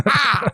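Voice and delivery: high acted cry; transformation cry. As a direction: cry out as if turning into a beast. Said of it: "he just bends over and goes like".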